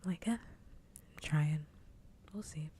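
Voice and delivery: soft, hushed streaming voice. Real, not acted. Streamer chatting to viewers about whisper streams, soft voice. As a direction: talk gently into the mic. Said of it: "I like talking at a soft voice".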